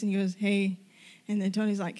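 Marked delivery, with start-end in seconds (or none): none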